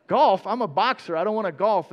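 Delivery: a boxer offended by the idea; indignant disbelief. Retold or imitated. Boxer scoffs at his fiancée's golf idea, indignant.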